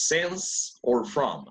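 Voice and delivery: slow, teacher asking the class; slow question. Shown as a demonstration. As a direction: draw out the choice slowly. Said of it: "teacher asks the class, slowly".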